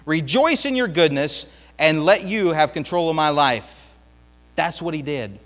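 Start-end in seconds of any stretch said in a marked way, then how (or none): 0.0-3.9 trusting prayer. Acted. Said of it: "he says".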